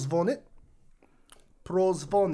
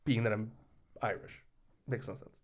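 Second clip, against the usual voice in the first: usual speaking voice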